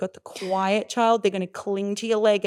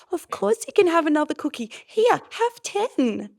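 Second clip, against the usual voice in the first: mock indulgent parent; 9 semitones higher, 5 dB louder than usual. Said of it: "They don't like hearing".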